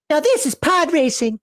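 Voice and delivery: high-pitched Star Wars quote impression; movie impression. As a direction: quote the pod racing line in character. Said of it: "Don't ever do that again".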